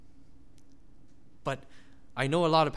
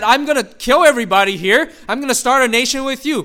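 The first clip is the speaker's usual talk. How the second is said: emphatic God quote; 4 semitones higher, 9 dB louder than usual. Pretend. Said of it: "God just said".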